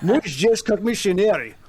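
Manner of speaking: put-on Russian put through a slushy Scottish accent; Sean Connery accent. Frustrated missionary at the door, hammed-up Scottish accent. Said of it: "Russian with a Sean Connery accent".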